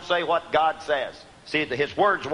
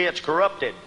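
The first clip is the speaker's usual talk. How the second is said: ordinary talk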